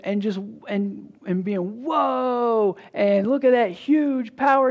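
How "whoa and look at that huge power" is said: higher, louder wide-eyed wonder; mock awe. Crowd marvelling at a mighty messiah, awed and louder. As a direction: marvel at the show of force. Imagined people awed by power.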